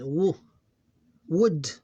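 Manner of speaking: "wo", then "would" in one loud beat; one syllable. Shown as a demonstration. Teacher going over it again, one loud syllable. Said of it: "That's one syllable".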